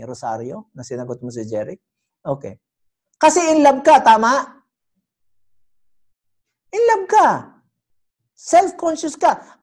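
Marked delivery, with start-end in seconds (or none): 3.1-9.6 teasing. Acted. Teasing a congregant about crush.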